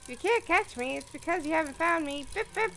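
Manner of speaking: high, singsong teasing voice; playful character voice. Acted. Character teases the player to catch him, high and singsong. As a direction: tease like a hide-and-seek child. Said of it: "That's him talking".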